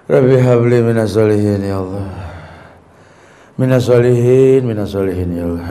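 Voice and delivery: earnest pleading prayer. Shown as a demonstration. Preacher mimics a weary parent begging God for good children. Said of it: "Agak serius dikit".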